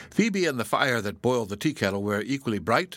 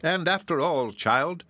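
exclaiming; 7 semitones higher, slower than usual. A narrator's reading. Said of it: "exclaimed Hepzibah".

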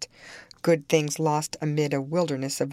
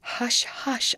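whisper; 8 semitones higher than usual. A narrator's reading. Nurse soothing weeping Cathy in a whisper. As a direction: whisper to soothe the crying girl. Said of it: "I whispered".

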